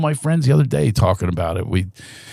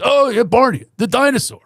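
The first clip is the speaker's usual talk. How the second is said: put-on much higher, bright kid voice; high innocent child's reply; clueless kid; 12 semitones higher than usual. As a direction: play the oblivious young audience member. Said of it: "I had a kid in the audience".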